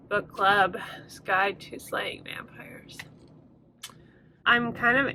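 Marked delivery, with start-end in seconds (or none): 0.1-3.0 whispered title. Acted.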